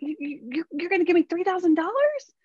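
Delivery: high, stammering with delight; can't believe the offer; excited daughter. Retold or imitated. Daughter asking mom about the money, high and thrilled. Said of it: "she was excited".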